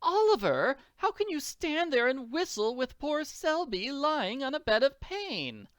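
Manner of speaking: high-pitched, dramatic scolding; reproachful wife. Acted. Wife scolding her husband for whistling, reproachful.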